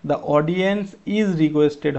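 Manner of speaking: slow, word by word reading of the answer; slow dictation. Shown as a demonstration. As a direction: spell the sentence out slowly for students. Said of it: "is বসাবো".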